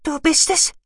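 very high creature voice, questioning; high, thin, disbelieving question; whispering creature. A narrator's reading. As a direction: ask in a tiny, squeaky voice. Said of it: "flüsterte er".

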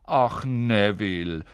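slow, weary grandma sigh; sighing old woman. Acted. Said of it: "hörte er die alte Frau seufzen".

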